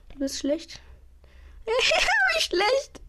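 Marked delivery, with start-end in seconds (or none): 1.6-2.3 high squealing laugh; shrieking laugh. Real, not acted. 2.3-3.0 laughing mockery. Real, not acted. Mocking teammate, laughing.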